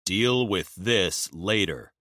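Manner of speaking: firm calm. Acted. Magnus voice of reason.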